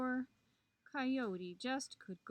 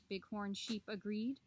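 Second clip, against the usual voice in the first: ordinary delivery, nothing marked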